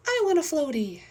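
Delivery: high, loud, demanding little voice; eager child. Acted. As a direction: pipe up wanting a toy. Voices child begging for a floaty.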